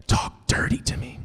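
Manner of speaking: husky whisper, voiceless. Retold or imitated. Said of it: "she goes".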